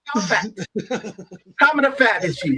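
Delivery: high, put-on Italian accent; hammed-up Italian-accented questioning; mock Sicilian granddad. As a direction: play a nosy Sicilian elder. Plays along as Sicilian granddad.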